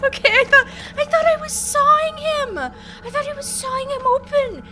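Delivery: high, loud, rattled telling; shaken explanation. Real, not acted. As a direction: still panicked as you explain. Explaining fright still shaken.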